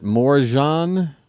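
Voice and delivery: drawn-out Morjan, French zh sound; French-style J. Shown as a demonstration. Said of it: "Something a little more French".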